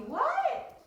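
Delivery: what? leaping high in mock surprise. Teacher to pupil, playfully surprised. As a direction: act amazed at the answer. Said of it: "mock surprise at answer".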